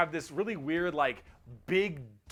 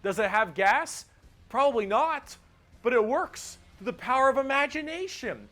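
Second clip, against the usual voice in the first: excited punchline; 7 semitones higher, 5 dB louder than usual. Real, not acted. Excited punchline about orc tech.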